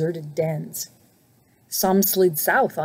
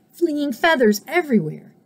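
ordinary delivery

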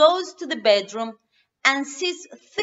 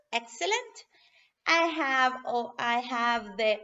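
ordinary delivery, nothing marked

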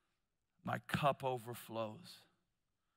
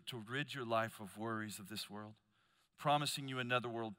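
ordinary delivery

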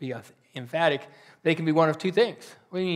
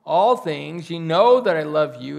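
grieved; 8 dB louder, slower than usual. A narrator's reading. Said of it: "And Peter was grieved".

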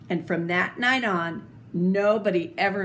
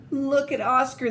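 higher, teasing dog voices; dogs crying out; 6 semitones higher, 3 dB louder than usual. A narrator's reading. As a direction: call out teasing Oscar. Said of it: "they cried".

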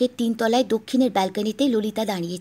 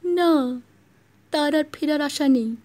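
higher mock maid's voice; imitating the maid; 5 semitones higher than usual. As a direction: put on the maid's voice to tease. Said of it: "ললিতার গলা নকল করে".

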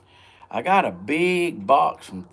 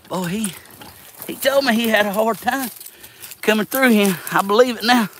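higher, louder exclaiming; excited; 10 semitones higher, 4 dB louder than usual. Real, not acted. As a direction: call out in amazement. Exclaiming while laboring uphill.

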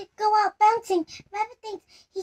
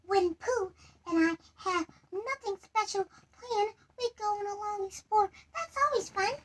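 timid Piglet; 3 semitones higher, 8 dB quieter than usual. Acted. Piglet speaking shyly.

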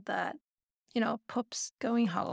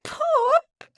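high, loud surprised call of the name; spotting the lost cat at last; 5 dB louder than usual. Retold or imitated. Spotting the lost cat on a porch, high surprised call.

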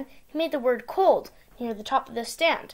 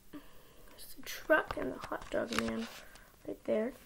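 soft whispered aside; whispering; 2 semitones lower, 15 dB quieter than usual. Real, not acted. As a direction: point it out in a whisper.

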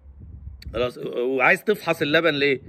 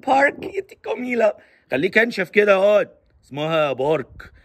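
mocking heckler; 4 semitones higher than usual. Mocker telling a friend to toughen up, mocking. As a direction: ridicule the correct word, then firmly say it wrong. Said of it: "يعني تتريق".

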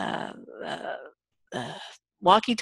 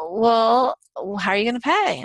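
louder, disbelieving well; incredulous waiter; 4 semitones higher, 4 dB louder than usual. Retold or imitated. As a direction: press him on paying, baffled. Waiter confronts non-paying customer.

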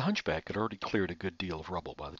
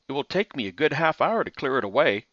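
higher, louder simple hunchback voice; hunchback assistant; 5 semitones higher, 6 dB louder than usual. A narrator's reading.

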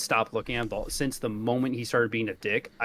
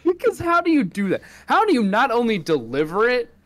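loud, high-pitched disbelief; incredulous; 8 semitones higher, 7 dB louder than usual. Real, not acted. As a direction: demand an explanation, voice way up. Outraged disbelief at the botched delivery.

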